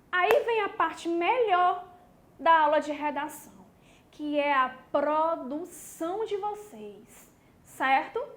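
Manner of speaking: usual speaking voice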